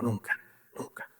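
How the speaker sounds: very soft, low, choked-up murmur; retold tearful reply. Weeping brother embracing him in hospital, tearful. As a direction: barely voice it, as if through tears. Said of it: "se me abalanzó llorando".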